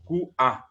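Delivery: "Q A" broken by a glottal catch; two cut-apart syllables in "Q A"; glottal stop. Shown as a demonstration. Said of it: "K U puso A".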